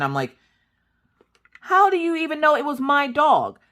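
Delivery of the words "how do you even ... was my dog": high, loud demanding question; indignant challenge. Retold or imitated. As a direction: push back hard, pitched way up. Arguing with police over dog.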